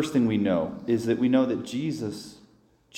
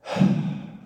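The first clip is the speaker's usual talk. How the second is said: heavy sigh. Real, not acted. Before praying honestly to God.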